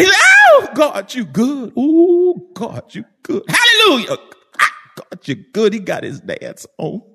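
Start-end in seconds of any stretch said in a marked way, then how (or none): 0.5-5.7 imitated church shout. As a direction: act out a worshipper whooping in bursts. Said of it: "he would shout all over that church".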